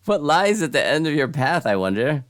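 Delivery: high, loud theatrical townsperson; wandering stranger. Acted. Voices wanderer in game.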